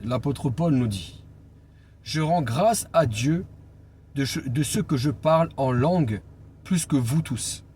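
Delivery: ordinary talk, nothing marked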